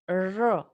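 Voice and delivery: R sounded alone as "ruh", tongue curled back; retroflex R demo. Shown as a demonstration. Teacher models English R as in red, retroflex "ruh". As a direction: curl the tongue back for an isolated R. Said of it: "is a post-alveolar".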